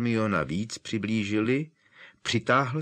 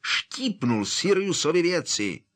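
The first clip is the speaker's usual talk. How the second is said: high, exploding indignant cry; angry outburst; 9 semitones higher, 3 dB louder than usual. Acted. Harry explodes inside the pub, high outraged outburst. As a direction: burst out, outraged. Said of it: "Harry rozčileně vybuchl".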